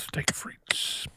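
breathy whispered echo. Pretend. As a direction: whisper back the words. Echoes in whisper.

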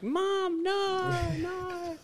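put-on very high squeaky kid voice; whiny kid. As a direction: squeak it like an embarrassed child. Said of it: "being like".

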